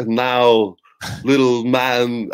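lightly attempted celebrity impression, drawn out; a hint of a badly done actor impression; Christopher Walken. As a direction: imitate a poor impersonator, stretching the words. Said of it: "he does the Christopher Walken voice".

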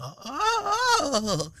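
high drawn-out mock "ohh"; imitated wail. Storyteller mimicking the other man's reaction, high wail. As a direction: wail it high, then let it fall.

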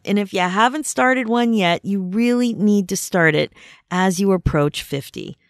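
usual speaking voice

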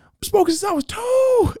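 high-pitched loud squawk; hammed-up squealing movie quote; Smokey from Friday. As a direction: shout it high, dragging out the end. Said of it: "revert right back to Friday".

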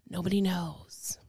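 hushed mystery whisper on "Nobody knows". Pretend. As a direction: breathe "Nobody knows" like a secret.

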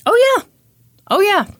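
high-pitched, knowing "oh, yeah"; emphatic confirmation. Real, not acted. Co-host confirms the shocking sex offender classes, emphatic and high.

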